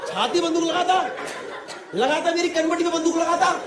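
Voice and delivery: high-pitched comic outrage; नाटकीय शिकायत. Acted. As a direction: rant in exasperated disbelief. Comic outrage at absurd threat. (ऊँचे सुर में झुंझलाकर)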